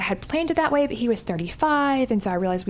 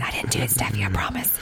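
a soft whispered aside, much lower; joking whisper; 11 semitones lower, 6 dB quieter than usual. Real, not acted. Promising absent friend Steffi she never did her accent, whispered. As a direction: whisper it like a guilty secret.

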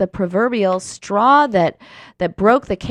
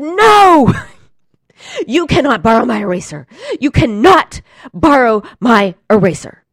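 much louder, higher shout with a laugh; the moment I blew up at my roommate; retold blow-up; 4 semitones higher, 10 dB louder than usual. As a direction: snap back at the roommate, finally fed up. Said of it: "I blew up".

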